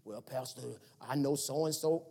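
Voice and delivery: mumbled excuse from a skeptic; lightly voiced skeptic in the pews; doubting churchgoer. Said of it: "Do you believe God or not?".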